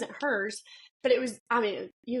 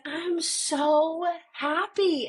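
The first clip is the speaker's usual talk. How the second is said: slow, higher, drawn-out joy; delighted; 7 semitones higher, 4 dB louder, much slower than usual. Retold or imitated. Mother telling her daughter she's so happy, slow and delighted. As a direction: savor each word with delight. Mom overjoyed at daughter's conscience.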